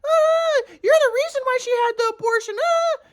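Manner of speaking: very high mocking falsetto with wailing squeals; mocking his mom. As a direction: mimic his mother scolding in a squeaky, hammed-up wail. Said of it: "my mom will later be like".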